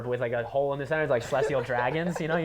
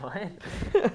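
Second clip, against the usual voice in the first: sharp high surprised what; shocked what; 8 semitones higher than usual. Retold or imitated. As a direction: blurt it out in disbelief. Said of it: "I was like".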